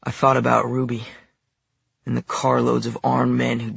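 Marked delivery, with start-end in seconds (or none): none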